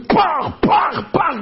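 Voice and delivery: high, explosive bangs; vocal cannon sound effect; imitated gunfire. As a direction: punch out shell blasts with the voice.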